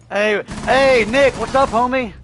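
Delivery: high, loud bro greeting; hyped greeting. Retold or imitated. Imitating a hype greeting to his buddy Nick, loud and high. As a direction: shout it like greeting your homie.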